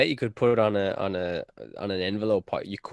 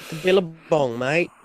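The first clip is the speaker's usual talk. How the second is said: hammed-up Aussie twang, higher and louder; Australian accent; 7 semitones higher, 3 dB louder than usual. Friend joking about the billabong in a mock Australian accent. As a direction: play up a broad Australian accent.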